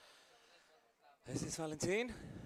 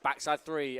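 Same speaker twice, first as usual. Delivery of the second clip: normal talking voice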